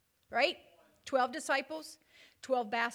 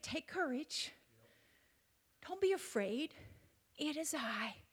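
softer, reassuring Jesus voice; calm him gently, barely above a hush; Jesus quietly calming Peter on the water; 8 dB quieter than usual. Acted. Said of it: "And he said".

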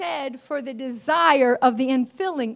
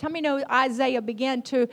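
normal talking voice

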